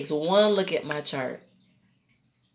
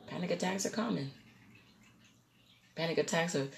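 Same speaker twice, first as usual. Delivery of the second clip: calm doctor; 13 dB quieter, faster than usual. Retold or imitated. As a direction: explain it quietly, unfazed. Voices doctor answering calmly.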